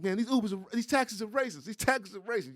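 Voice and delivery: high-pitched, indignant complaint; imitated high-voiced rant; ranting street guy. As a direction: retell a worked-up man griping. Retells homeless man's street rant.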